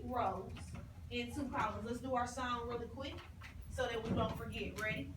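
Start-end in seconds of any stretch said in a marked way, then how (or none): none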